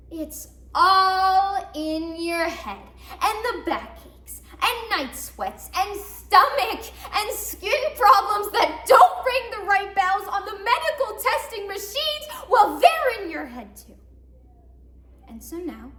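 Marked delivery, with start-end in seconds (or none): 0.1-2.8 loud, long drawn-out ALL; mocking refrain. Others answering a scared woman, loudly mocking. 3.2-14.0 high, loud tirade; indignant rant. Acted. Patient reciting her dismissed ailments, loudly indignant.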